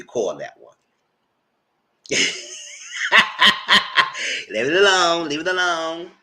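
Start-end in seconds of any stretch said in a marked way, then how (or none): 2.0-4.5 sudden high cackle at her own joke; high burst of laughter. Real, not acted. Vlogger cracking up at the nickname, high cackle. 4.5-6.1 laughing through the words, louder. Real, not acted. Vlogger telling viewers to drop it, laughing.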